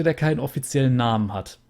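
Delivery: ordinary talk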